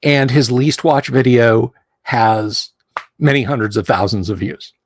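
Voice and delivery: ordinary delivery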